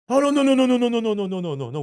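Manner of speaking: hammed-up frantic backpedal, caught out; panicked denial. Retold cop's frantic denial.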